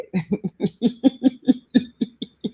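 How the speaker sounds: long run of giggles; real, helpless chuckling; amused laugh. Real, not acted. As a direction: laugh at your own admission. Amused at her own mad voice.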